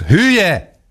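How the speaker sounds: még magasabb, éles ordítás: „Hülye!”; kiáltás. A narrator's reading. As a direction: szinte visítsa a „Hülye!” szót. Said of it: "kiáltotta ismét". (dühös, magas ordítás)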